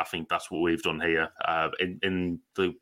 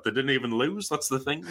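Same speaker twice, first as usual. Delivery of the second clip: Paul Addison quote; 6 semitones higher than usual. Retold or imitated. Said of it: "he was like".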